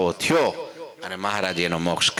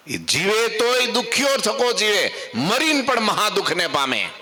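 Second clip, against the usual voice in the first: impassioned preaching; 10 semitones higher than usual. Acted. Preacher warning the gathering, high and impassioned. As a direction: drive home the misery of ego.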